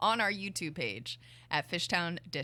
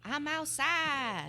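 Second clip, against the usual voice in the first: very high, slow, stretched-out cry of I'm outside; silly sing-song; 10 semitones higher, much slower than usual. Acted. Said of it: "What the heck was that?".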